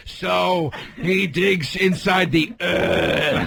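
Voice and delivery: very slow, dragged-out words with bent Rs; mocking a bad accent. Mocking cohost's accent, slow and bending Rs. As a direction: imitate a friend's clumsy accent, hamming it up. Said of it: "doing an accent as just bend the R".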